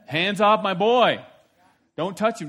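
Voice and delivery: put-on God shouting hands off my boy; loud warning. God's warning repeated loudly.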